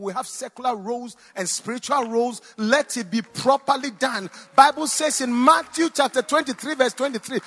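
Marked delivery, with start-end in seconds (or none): 4.6-7.4 high, loud scripture proclamation; forceful preaching. Real, not acted. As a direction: proclaim the verse to the congregation with force.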